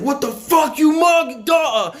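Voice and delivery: big guard yelling high and loud; aggressive shout. Retold or imitated. Big staff member shouting at the new kid. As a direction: bark it at the kid like a threat. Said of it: "He said".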